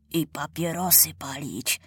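whispered naughty aside; sly whisper. A narrator's reading. Child deputy slipping in a forbidden wish, whispered. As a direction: whisper it like a cheeky secret.

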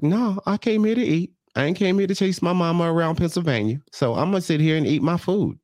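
high-pitched imitation of a stubborn daughter; defiant refusal. Daughter refusing to chase her mother, staying to eat, defiant.

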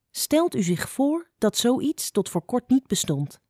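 ordinary delivery, nothing marked

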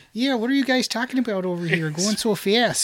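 put-on Canadian accent. Mocking the accent change at the border, light Canadian accent. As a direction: sound like a puzzled Canadian over the border. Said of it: "the accent goes from, like".

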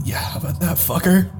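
soft smug grumble under the breath; muttering to himself. Acted. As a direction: mutter it triumphantly to yourself. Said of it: "I mutter to myself again".

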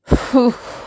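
loud, long weary sigh; heavy sigh of emotional exhaustion. Real, not acted. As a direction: let out a big exhausted sigh. Overwhelmed by emotion.

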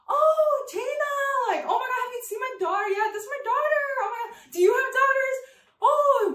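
high, excited mock mom voice; gushing mother. Mother showing off her daughter to the driver, high and gushing. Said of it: "And she'll be like".

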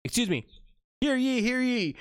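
hammed-up herald calling a crowd to attention; town crier. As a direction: proclaim it like a medieval crier. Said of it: "Sir Rami Lancelot".

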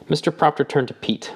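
plain reading voice

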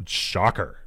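low, dry sarcastic aside; deadpan mock surprise. Real, not acted. Sarcastic aside during reading.

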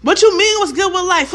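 loud, high-pitched mock outrage; crazy person snapping. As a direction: fire back like someone unhinged. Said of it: "only a crazy person sounds like that".

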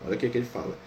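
ordinary talk

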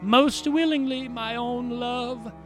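tender answer. Acted.

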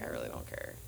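low breathy shrug; flat whisper. Retold or imitated. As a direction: toss it off in a whisper. Shrugging reply to boyfriend.

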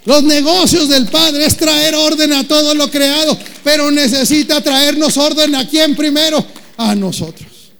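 loud, high preaching shout; full-force preaching. Acted. Predicación con fuerza. (predicación a gritos)

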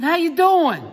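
high, loud phone holler; shouted greeting. Retold or imitated. Referee greeting old coach on a bad line, shouting. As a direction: holler it over a bad connection. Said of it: "it's difficult reception".

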